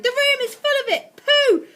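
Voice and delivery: very high, loud shrieking witch; angry witch voice. Acted. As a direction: shriek with outrage and disgust. Witches raging.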